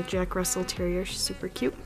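usual speaking voice

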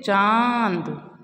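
चांद drawn out very slowly, nasal vowel held long; slowly sustained चांद. Shown as a demonstration. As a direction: stretch चांद, lingering on the nasal आं. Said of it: "उसको हम थोड़ा रुक के पढ़ते हैं".

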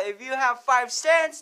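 normal talking voice

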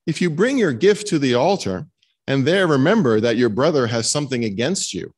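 ordinary talk, nothing marked

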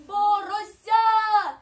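high, loud snowflake calling friends; slow, high, ringing announcement; shouting. A narrator's reading. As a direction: stretch it out in an excited shout. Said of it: "крикнула сніжинка подруга".

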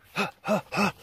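quick startled intake of breath; real startled gasp; sharp surprised gasp. Real, not acted. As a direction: gasp as something catches your eye. Sudden surprise mid-answer.

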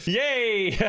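gleeful cheer. Real, not acted. President cheering when allowed to launch the nukes, gleeful.

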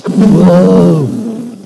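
loud, sudden whoa as the system shorts; robot yelps as the breaker shorts out; startled shout. Real, not acted. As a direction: jolt back in alarm. Breaker blows up.

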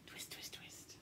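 a hushed, rhythmic whisper. A narrator's reading. Storyteller whispering the twisting to the children. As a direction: whisper each twist like a little spell. Narrator whispers sound effect words.

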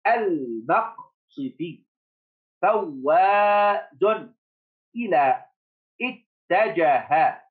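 each word held long and slowly; very slow reading. Shown as a demonstration. Teacher reading scrambled words slowly to the class. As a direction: read the cards out word by word, slowly. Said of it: "teacher reading word cards".

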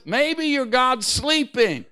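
high, drawn-out mock suggestion. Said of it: "He's mocking them".

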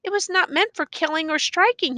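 put-on high, protesting boy's voice; rising voice. A narrator's reading. Boy defending the iron bar to the judge, voice rising. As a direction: let the voice rise in protest. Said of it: "The boy's voice ri-, rises".

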